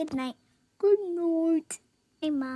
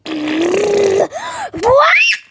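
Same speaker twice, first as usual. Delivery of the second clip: loud gasp; 5 semitones higher, 12 dB louder than usual. Acted. Startled in pretend play.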